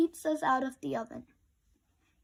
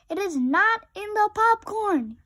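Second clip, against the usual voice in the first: high, loud frantic shout; 8 semitones higher, 6 dB louder than usual. A narrator's reading. Rabbit shouting as he dumps the popcorn. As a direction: yell it in a panic. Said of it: "he shouted".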